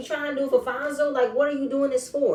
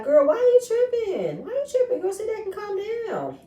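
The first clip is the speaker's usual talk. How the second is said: much higher, mocking why are you tripping; mock girl tripping; 11 semitones higher than usual. Mocking Shay's outburst at the table. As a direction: ham it up high and sassy.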